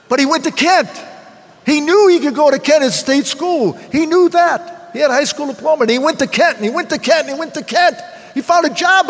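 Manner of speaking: rising pride. Real, not acted. Father telling the AA room about his son, proudly rising.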